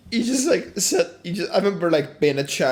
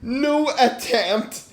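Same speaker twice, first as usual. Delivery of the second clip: laughing; 7 semitones higher, slower than usual. Real, not acted. Laughing while telling story.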